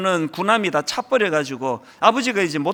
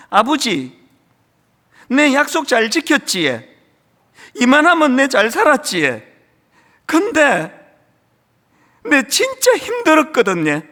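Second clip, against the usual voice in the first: louder, rising emotion toward tears; tearful Gyeongsang dialect; 6 semitones higher, 5 dB louder than usual. Acted. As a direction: Gyeongsang lines with a voice building to near tears. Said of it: "그 다음 대사가 뭐냐 하니까요". (울먹이며 경상도 사투리로)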